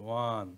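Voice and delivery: ওয়ান said with a clear wa sound at the start; pronunciation demo. Shown as a demonstration. Teacher showing students how to say ওয়ান, clear demo. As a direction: spell out ওয়ান as wa-n for the class. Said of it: "ওয়ান যখন উচ্চারণ করবেন তখন কিন্তু ওয়া আসতেছে".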